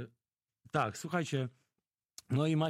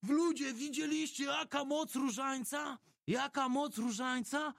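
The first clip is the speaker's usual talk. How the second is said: much higher, slow, amazed call to the crowd; future priest exclaiming; 11 semitones higher than usual. Retold or imitated. Future priest calling to the passengers, high amazed exclaim. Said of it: "Amadeusz krzyczy".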